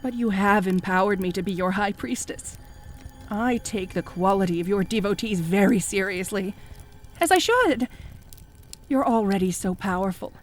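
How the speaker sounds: low, soft, soothing devotion; creepy calm cultist. Acted. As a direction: lull him with quiet flattery.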